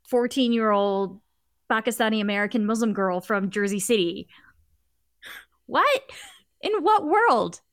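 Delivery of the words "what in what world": higher, louder, incredulous; high louder astonishment; amazed disbelief. Real, not acted. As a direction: exclaim it in disbelief. Amazed at the character.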